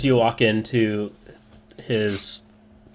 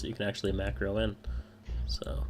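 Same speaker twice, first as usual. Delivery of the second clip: whisper; 11 dB quieter than usual. Real, not acted. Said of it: "unvoiced whispered aside".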